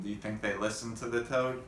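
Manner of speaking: usual speaking voice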